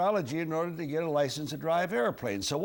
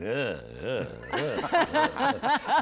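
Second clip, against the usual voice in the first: engine-like laugh struggling to catch, then louder; imitated Bobby Regan laugh; 2 semitones lower, 5 dB louder, much slower than usual. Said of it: "and he went".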